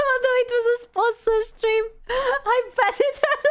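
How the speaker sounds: high, laughing through the words; cracking up in disbelief; laughing hard. Real, not acted. Realizes it was sponsored.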